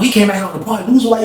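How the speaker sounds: ordinary talk, nothing marked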